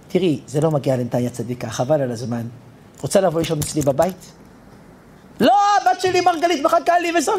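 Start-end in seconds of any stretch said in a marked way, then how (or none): 0.1-4.3 gentle. Retold or imitated. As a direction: lower and softer, coaxing. Chaim gently tells the old woman. (בעדינות ובקול רך ונמוך)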